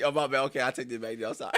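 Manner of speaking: usual speaking voice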